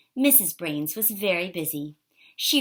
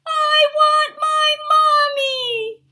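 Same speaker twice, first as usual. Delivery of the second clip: put-on crying baby wail, much higher and louder, slow; 12 dB louder, much slower than usual. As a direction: sob it out, desperate for mommy. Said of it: "wailed Baby Brains".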